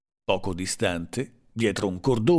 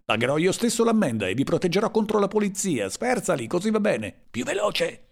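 high-pitched, agitated orders; frantic urging; 9 semitones higher than usual. A narrator's reading. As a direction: keep pushing the driver, pitch held high. (incitamento concitato, in tono acuto)

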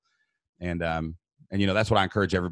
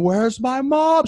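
high, slow panicked cry; frightened child; 7 dB louder, much slower than usual. Retold or imitated. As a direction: stretch it out like a terrified boy. Scared son retold.

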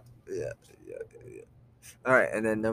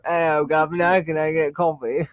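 loud, higher-pitched hammed-up British accent; mock British; 5 semitones higher, 8 dB louder than usual. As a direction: order like a cartoon Brit. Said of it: "You go into the coffee shop".

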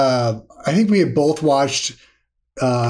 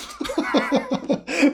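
laughing; 11 semitones higher, 5 dB quieter than usual. Real, not acted. Laughs at the four beers.

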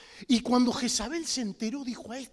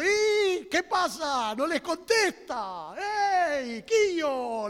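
slow, teasing Andalusian taunt, a bit louder; mocking Andalusian; 3 semitones higher, 4 dB louder, slower than usual. Elijah taunting the prophets of Baal, slow mocking Andalusian. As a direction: drawl it out in mock Andalusian scorn. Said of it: "le diría en andaluz".

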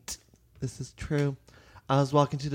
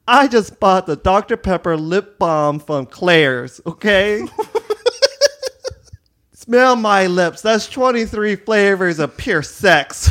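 put-on loud, sassy teen voice, louder and slow at first; a valley girl shutting down a stranger in the mall; 5 semitones higher, 8 dB louder than usual. Sassy teen at the mall brushing off a talent scout, loudly. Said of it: "I was like".

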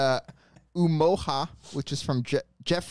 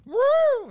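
excited whoop; 5 dB louder than usual. Pretend. Whooping after singing the track.

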